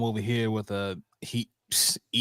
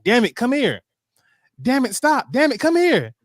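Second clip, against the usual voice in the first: high-pitched, louder imitation of a girl yelling at her dog; retold yelling; 12 semitones higher, 6 dB louder than usual. Little girl calling her dog on the farm, yelling. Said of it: "the little girl kept yelling to the dog".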